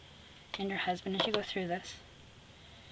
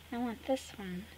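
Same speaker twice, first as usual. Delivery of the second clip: ordinary talk, nothing marked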